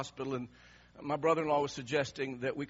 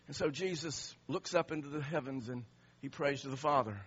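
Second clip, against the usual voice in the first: normal talking voice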